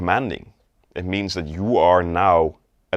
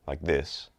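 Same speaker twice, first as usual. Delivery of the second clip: low, softer voice; low start; 4 semitones lower, 8 dB quieter than usual. Shown as a demonstration. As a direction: drop it down low and quiet. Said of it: "start a bit low".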